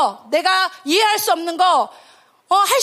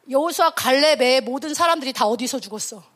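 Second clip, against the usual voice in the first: ordinary talk, nothing marked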